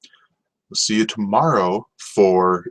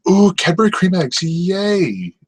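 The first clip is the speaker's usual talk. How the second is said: high, loud fake squeal of delight; mock excitement; 11 semitones higher, 4 dB louder, slower than usual. Mocking a candy fan's excited squeal. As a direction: gush over the eggs in mock glee. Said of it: "I'm not like".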